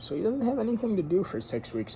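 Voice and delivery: high, fast "have anything to do"; emphatic recap. Shown as a demonstration. Reader recapping the story for chat, high and fast. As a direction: rush through the recap. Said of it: "six whole weeks".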